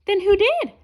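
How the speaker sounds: lightly voiced curious juggler; high eager asking. A narrator's reading. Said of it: "asked the jugglers".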